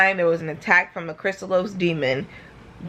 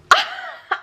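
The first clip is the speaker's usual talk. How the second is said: high laugh through tears. Real, not acted.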